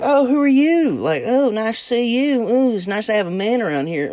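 very high-pitched put-on lady voices; mock flirty ladies. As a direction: imitate fawning women greeting a newcomer. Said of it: "every one of them that I would talk to, they'd be like".

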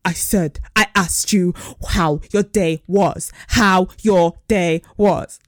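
slow, drawn-out irritated repeat; imitating his tone. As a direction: spell out his complaint slowly, word by word. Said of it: "this is his tone".

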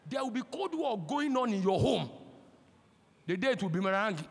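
ordinary delivery

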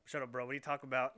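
a retold, incredulous challenge; mock disbelief. Older sibling retelling a retort to little brother, loud mock disbelief.

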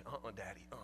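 low, soft refusal; a hint of a frightened kid shaking his head; scared little boy. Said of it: "Sam refusing again".

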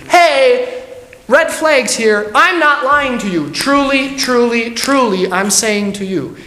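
high, loud, hammed-up voice of someone overselling the truth; mock insistent pleader. Pastor mocking someone who over-insists, hammed-up high voice. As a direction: play a fishy salesman swearing he's honest. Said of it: "it's an emphasizing factor".

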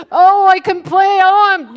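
high, loud grumbler's moan; hammed-up bellyaching; mock complaining wail. Preacher imitating people who complain, loud mock whine.

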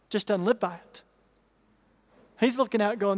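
ordinary talk, nothing marked

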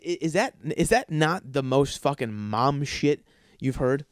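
normal talking voice

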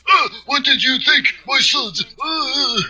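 put-on high, strained old master voice; old man voice. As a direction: do a lazy, half-hearted old sensei. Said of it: "weakest, you know, attempt at an old man voice ever".

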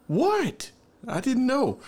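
high-pitched bewildered protest; mock shock. Guest ordered to throw his food away, shocked. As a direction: plead innocence way up high.

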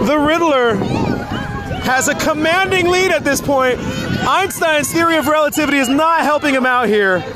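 excited play-by-play announcer; race commentator. Pretend. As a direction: call the race like a sportscaster.

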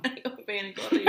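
fast, laughing complaint; half laughing, half hurt; laughing through pain. Real, not acted. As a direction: laugh it out after bumping the elbow.

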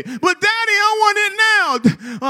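put-on high, slow pleading child; whiny child. Son begging his daddy for the T-Rex now, whiny. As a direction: whine it like an impatient little boy. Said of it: "child's reply".

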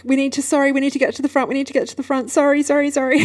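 high, breathless-sounding mock panic; frantic apologising. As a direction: re-enact pushing through with hurried apologies. Retold pushing through airport crowd.

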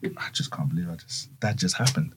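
soft, low stunned murmur; stunned hush. Retold or imitated. To Regal's friends after the photo, a soft stunned murmur. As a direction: barely say it, still in shock. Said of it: "I'm just like".

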